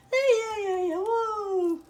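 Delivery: high, loud cheer with a slow woo; excited whoop. Acted. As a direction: whoop it up like a party. Cheering while adding onion.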